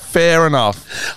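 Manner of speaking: slow, laughing through the words; real giggles on a dry remark. Real, not acted. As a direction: let the laugh break the repeat. Repeat dissolving into laughter.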